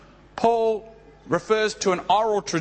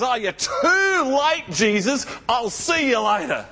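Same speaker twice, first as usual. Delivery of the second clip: grief-stricken outburst; 5 semitones higher than usual. Pretend. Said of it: "could have got wild with grief and rage".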